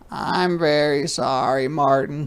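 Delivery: a hint of a sorry little girl; child's apology. Ruthie apologizes to Martin.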